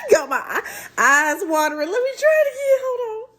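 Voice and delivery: high loud laughing with watering eyes; laughing through tears. Real, not acted. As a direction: laugh helplessly, half crying.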